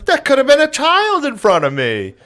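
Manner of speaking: very high, loud screech; mock scolding woman. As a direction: shriek the accusation like an outraged stranger. Imitates woman scolding him outside car.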